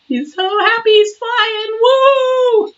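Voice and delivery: high, sing-song cheer, rising; excited cheer. Real, not acted. Reader cheering the happy card figure in a high sing-song.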